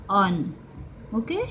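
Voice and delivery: ordinary talk, nothing marked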